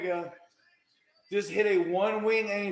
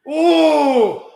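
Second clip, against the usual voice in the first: surprised ooh; 9 semitones higher, 9 dB louder than usual. Real, not acted. Near fall reaction.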